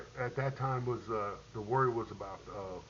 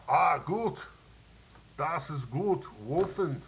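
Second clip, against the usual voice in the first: put-on higher, louder German-accented cheer; hearty German praise; 5 semitones higher, 6 dB louder, slower than usual. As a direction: boom it like a German soldier toasting you. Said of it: "It's like".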